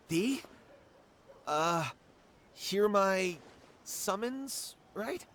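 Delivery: hushed, halting ritual chant; stumbling through a half-learned spell; mock incantation. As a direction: intone it like a nervous summoner. Rehearsing a summoning incantation.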